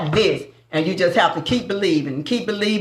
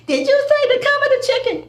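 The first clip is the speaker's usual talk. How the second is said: much higher, mock-innocent question; mimicking cousin. Retelling the forgetful cousin's question, in a high mock voice. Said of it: "and then she asked at the end".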